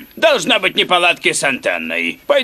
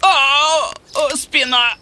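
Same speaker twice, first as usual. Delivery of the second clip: higher-pitched groaning over a sore back; pained groan; 7 semitones higher, much slower than usual. Acted. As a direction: moan as your back twinges. His back hurts while climbing. (стон от боли в спине)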